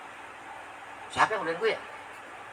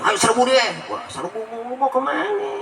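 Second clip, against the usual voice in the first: mock mob cry; 4 semitones higher than usual. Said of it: "Pasukan ini, pasukan".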